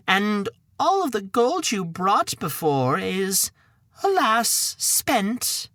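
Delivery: lightly voiced mother, sighing over spent gold; a mild touch of a worried mother; mother's lament. Mother scolding her son over money, mild lament.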